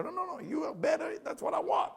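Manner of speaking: high insisting. Retold or imitated. Student insisting she's better.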